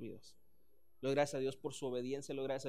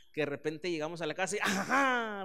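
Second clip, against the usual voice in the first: mock gotcha; 3 semitones higher, 8 dB louder than usual. Pastor catching family by surprise.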